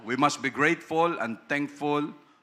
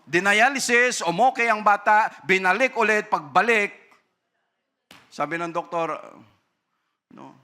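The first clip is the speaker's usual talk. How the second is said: louder, higher impassioned preaching; forceful preaching; 4 semitones higher, 4 dB louder than usual. Real, not acted. Preacher warning parents, loud and impassioned. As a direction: drive the point home to the congregation.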